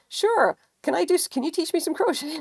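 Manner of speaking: lightly voiced eager teacher; chirpy quote of Sharon. Sharon asking to learn crochet, chirpy. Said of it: "And she was like".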